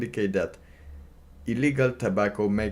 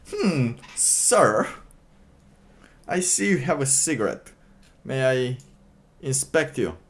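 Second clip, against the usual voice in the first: higher, louder, prissy official voice; mock inspector; 5 semitones higher, 4 dB louder than usual. Streamer voicing a fussy inspector who stops a smoker, higher and louder. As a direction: play a fussy cigarette inspector stopping a smoker.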